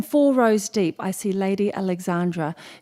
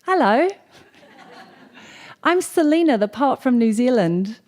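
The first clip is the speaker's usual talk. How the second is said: acting out a chirpy Kiwi hello; Kiwi friendliness; 2 semitones higher than usual. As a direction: introduce yourself eagerly, pitched up. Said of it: "I do that Kiwi thing".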